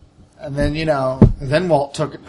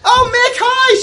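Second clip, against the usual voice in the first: very high, loud chirpy hello; Tiffany greeting; 9 dB louder, slower than usual. Acted. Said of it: "she says".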